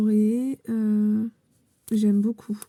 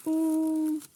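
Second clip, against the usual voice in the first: surprised oh; 6 semitones higher than usual. Real, not acted. Opening a gift box, delighted.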